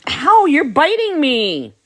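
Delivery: high, slow little puppy voice. Pretend. Breeder voicing the puppy as it bites, high slow puppy voice. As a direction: speak for the bitten puppy, high and drawn out.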